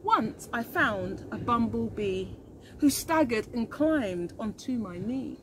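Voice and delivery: put-on slow, gentle read-aloud for children; storybook narration. A narrator's reading. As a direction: read it like a bedtime rhyme.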